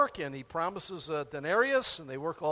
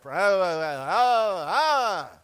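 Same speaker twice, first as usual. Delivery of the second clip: mock grumbling groan; 7 dB louder, much slower than usual.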